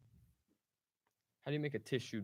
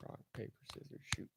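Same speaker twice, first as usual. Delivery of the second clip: whispering; 7 dB quieter, faster than usual. Real, not acted. Whispered rock paper scissors.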